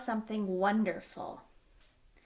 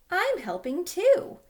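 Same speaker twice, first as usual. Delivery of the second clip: high, loud and slow eager voice; proud little puppy helping; 8 semitones higher, 9 dB louder, slower than usual. Acted. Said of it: "he thought".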